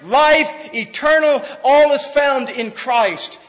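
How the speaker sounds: loud, high preacher's roar; preaching at full force. Acted. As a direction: ring each word out to the back pew. Preaching crescendo list.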